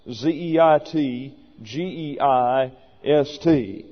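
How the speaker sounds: slow, letter-by-letter spelling. Shown as a demonstration. Teacher spelling a requested term for listeners, slowly letter by letter. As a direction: spell it out slowly for note-takers. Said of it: "I've been asked to spell".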